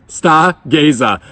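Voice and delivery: slow, showy Boston accent. Shown as a demonstration. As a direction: announce it in broad Boston, R's dropped. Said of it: "the best Boston accent band name".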